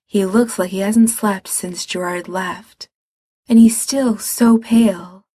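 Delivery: ordinary delivery, nothing marked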